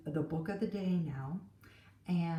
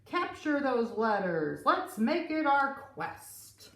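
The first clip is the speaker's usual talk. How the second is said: pirates; 5 semitones higher, 5 dB louder than usual. Acted. Pirates voiced in story.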